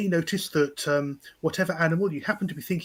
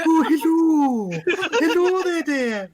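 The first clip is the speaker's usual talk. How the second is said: high, loud, drawn-out nanny hello; Missus Doubtfire; 11 semitones higher, 8 dB louder, much slower than usual. Acted. Player showing off an impression, hammed-up high nanny voice. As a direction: hoot it out like a hammed-up old dear. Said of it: "I can do Missus Doubtfire".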